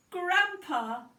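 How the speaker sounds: put-on high, loud joyful shout of a child; delighted cry. A narrator's reading. Little girl spotting her grandfather at the school gate, joyful cry. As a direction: call out his name with pure joy.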